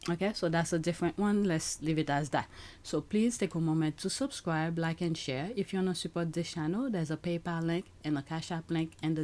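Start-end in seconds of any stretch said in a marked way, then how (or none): none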